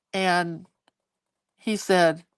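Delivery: ordinary delivery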